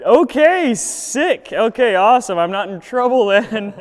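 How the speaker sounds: high, loud relieved answer; mock relief. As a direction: sound surprised and relieved. [name] relieved and surprised.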